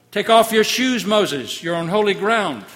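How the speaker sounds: God commanding Moses to remove his shoes; commanding divine voice. Acted. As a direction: order him with holy authority.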